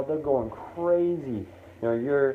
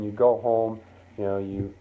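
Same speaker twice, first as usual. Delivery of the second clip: ordinary talk, nothing marked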